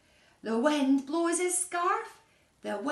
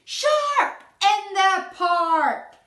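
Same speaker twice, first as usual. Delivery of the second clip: slow, loud warning cry; shout; 4 semitones higher, 10 dB louder, much slower than usual. Acted. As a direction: call it out at the top of your lungs. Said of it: "He shouts".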